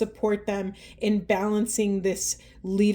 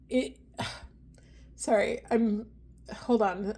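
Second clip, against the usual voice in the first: real tears; 6 dB quieter than usual. Real, not acted. Reader choking up while encouraging the masculines. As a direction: voice catching as she cries. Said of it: "I'm, like, ugly crying right now".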